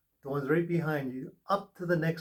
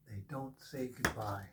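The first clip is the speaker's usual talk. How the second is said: soft echo; 4 semitones lower, 10 dB quieter than usual. Acted. Reader softly echoing the angels' saying to the viewer. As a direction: repeat it much softer, lower. Repeats the line, softer echo.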